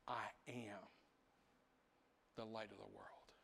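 quiet invitation. Retold or imitated. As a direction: say it like a gentle invitation. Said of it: "he backs up there and he says".

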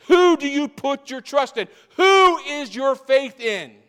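Who hit high and loud, slowed; stress on who. Real, not acted. Preacher pressing the congregation on whom they trust, stress on Who. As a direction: punch every Who. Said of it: "The emphasis is the who".

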